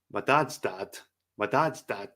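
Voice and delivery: ordinary talk, nothing marked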